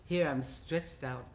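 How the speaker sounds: normal talking voice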